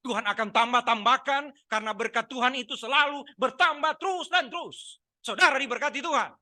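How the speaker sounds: mock preacher rising to a high cry; meniru pendeta berseru. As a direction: build up like a fired-up revival preacher. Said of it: "Kalau pendetanya sudah teriak begitu". (seruan pendeta yang makin meninggi)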